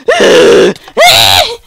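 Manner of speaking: wordless battle shriek to be heard afar; war cry. Shown as a demonstration. Gamer showing off his war cry, piercing yell. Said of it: "I have a war cry".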